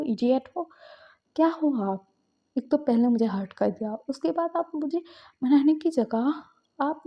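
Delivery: high, loud frantic telling-off; relieved angry scolding. Acted. Shinchan yells at Suyang, who nearly drowned, angry and scared. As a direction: scold him out of sheer fright.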